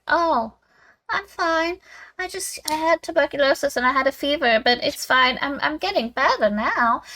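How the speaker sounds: high, airy put-on invalid voice; frail sick cousin. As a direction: play the ailing cousin insisting all is well. Voicing sick cousin downplaying illness.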